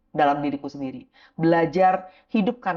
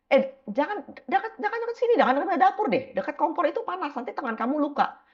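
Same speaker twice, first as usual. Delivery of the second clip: higher, urgent mother voice; parent warning child; 10 semitones higher than usual. Retold or imitated. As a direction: shoo the kid from the stove.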